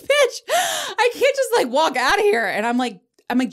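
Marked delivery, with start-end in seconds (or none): 0.0-2.5 shrill-free high incredulous protest; exasperated disbelief. Retold or imitated. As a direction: squeal it, can't believe what she's hearing. Reacting to Jill's absurd advice.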